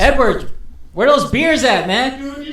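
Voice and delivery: loud, high in-character call. Acted. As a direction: holler across the inn for beers. Calling out in character.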